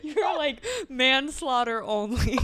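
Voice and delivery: laughing through the words. Real, not acted. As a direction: say it while cracking up. Joking quote while both laugh.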